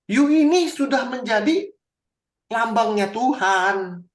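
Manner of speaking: loud, high and slow, pronouncing judgement; booming voice of God. Acted. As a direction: thunder it out as God rebuking the king. Preacher voicing God to Saul. (suara Tuhan yang keras dan lambat)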